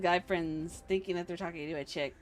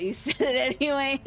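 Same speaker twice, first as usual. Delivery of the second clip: bursting into high laughter; laughing; 8 semitones higher, 9 dB louder than usual. Real, not acted. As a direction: laugh through the words. Reacts to a chat joke.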